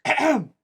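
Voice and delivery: loud, high "ahem"; bossy child's loud ahem; pointed throat-clear. Acted. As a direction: clear your throat to demand attention. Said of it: "when no one does, she turns and goes".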